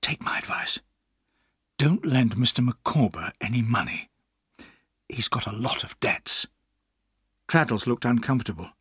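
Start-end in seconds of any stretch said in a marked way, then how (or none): 0.0-6.5 hushed confidential warning; secretive, half-whispered counsel. Acted. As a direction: whisper advice to a friend in private. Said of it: "I whispered".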